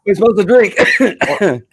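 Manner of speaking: giggling through the words; laughing. Real, not acted. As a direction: laugh while you say it. Laughing toast with guests.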